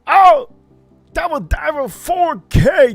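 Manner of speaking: sudden loud, high "Oh!" then a slow, excited name call; surprised outcry. Real, not acted. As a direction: burst out in real delight at the donor. Surprised by a big donation.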